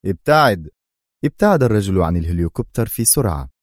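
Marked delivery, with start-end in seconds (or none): 0.1-0.7 louder, curt stern command; stern order. A narrator's reading. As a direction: order him away sternly. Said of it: "قال أدهم في صرامة".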